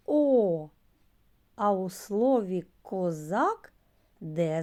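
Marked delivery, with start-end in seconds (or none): 0.1-0.7 long, loud open O vowel; sound demo. Shown as a demonstration. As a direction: sing out the sound О clearly. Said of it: "Зі звука".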